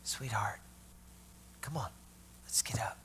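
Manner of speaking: put-on gentle whisper to a sleeping child; hushed and tender. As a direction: softly coax her awake in a whisper. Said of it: "he looks at her and says".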